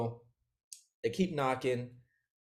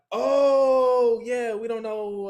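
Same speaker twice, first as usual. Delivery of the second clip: fake innocent; 8 semitones higher, 8 dB louder, much slower than usual. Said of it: "And they're like".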